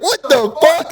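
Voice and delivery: very high-pitched incredulous cry; outraged disbelief. Retold or imitated. Pupil reacts to getting a red card, mock outraged disbelief.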